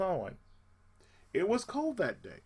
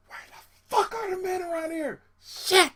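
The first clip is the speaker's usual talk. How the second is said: much higher, shouted complaint; exasperated inner yell; 12 semitones higher, 6 dB louder than usual. Retold or imitated. Lone man in choir complaining about no men, exasperated yell. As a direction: cry out in despair.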